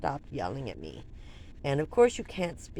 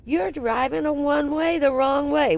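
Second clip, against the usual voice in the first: higher, louder mock scolding; imitating the commandant scolding her driving; retold yell; 8 semitones higher, 5 dB louder than usual. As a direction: commandant scolding his driver, mock angry. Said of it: "I will never forget".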